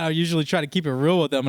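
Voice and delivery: ordinary talk, nothing marked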